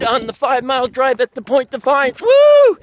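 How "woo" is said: loud high cheer of joy; excited whoop. Real, not acted. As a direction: let out a big joyful woo. Cheering nearing finish line.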